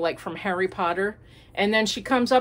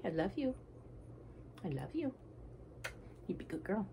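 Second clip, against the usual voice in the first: softer tender words to the dog; tender murmur; 17 dB quieter, faster than usual. Real, not acted. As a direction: murmur love to the pet.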